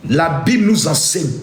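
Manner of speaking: ordinary talk, nothing marked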